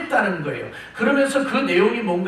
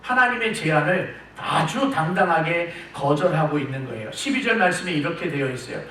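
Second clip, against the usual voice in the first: ordinary delivery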